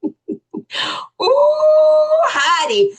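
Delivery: high, loud, drawn-out ooh; playful scandalized whoop. Acted. Host reacts to viewer's comment, playful high whoop. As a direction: whoop it up over a juicy comment.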